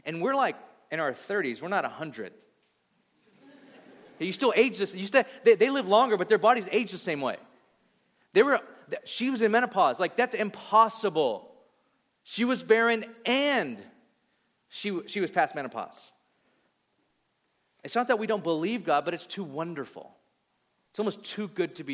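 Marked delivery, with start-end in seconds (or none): 4.2-16.1 rising preach. Real, not acted. Preacher building on impossibility.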